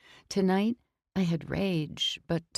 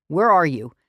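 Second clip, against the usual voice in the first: urgent shout; 10 dB louder than usual. Acted. Furious urgent demand.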